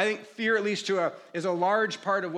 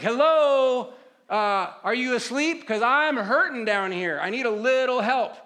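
higher, loud mock call to wake someone; exasperated wake-up call; 4 semitones higher, 6 dB louder than usual. Believer trying to wake God up, exasperated and loud. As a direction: call out sarcastically for attention.

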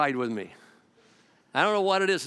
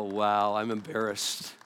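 sheepish; 8 semitones lower, slower than usual. Retold or imitated. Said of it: "And we go".

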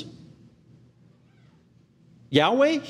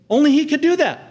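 much higher, louder proclamation; emphatic shout; 10 semitones higher, 4 dB louder than usual. Acted. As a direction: cry it out triumphantly to the congregation. Preacher repeats line for emphasis.

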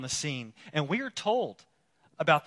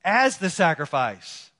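raised, emphatic preacher's delivery; forceful preaching; 6 semitones higher, 9 dB louder than usual. Acted. Preacher telling the church of the priest who never retreats, forceful preaching. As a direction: drive each phrase home like a preacher in full flow.

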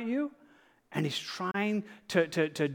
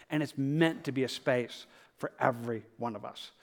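ordinary delivery

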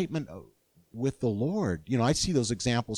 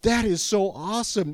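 excited; 8 semitones higher, 6 dB louder than usual. Retold or imitated. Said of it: "You're like".

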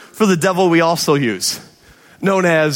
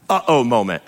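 ordinary talk, nothing marked

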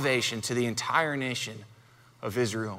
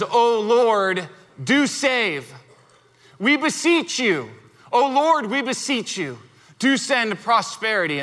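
raised, earnest scripture reading; psalm read as prayer; 4 semitones higher than usual. Acted. Preacher reading the psalm as a plea to the Lord, earnest.

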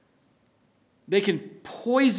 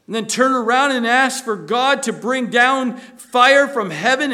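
loud, rising sermon delivery, pressing the congregation; preaching at full force; 7 semitones higher, 6 dB louder than usual. Acted. Preacher warning his congregation, loud full-force preaching. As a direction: preach it hard, pleading and warning.

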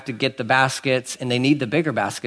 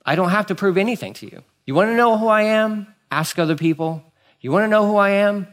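higher-pitched, insistent challenge; defiant refusal; 7 semitones higher than usual. Acted. Jesus refusing to prove himself to the Pharisees, defiant. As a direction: push back, refusing to justify yourself.

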